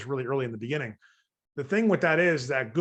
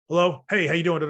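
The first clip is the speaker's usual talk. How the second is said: louder, sharper hello and greeting; louder tough-guy phone greeting; 3 semitones higher, 6 dB louder than usual. Shown as a demonstration. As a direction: snap back, cut in hard. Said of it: "real tough to talk to, I'm gonna be a little bit tougher".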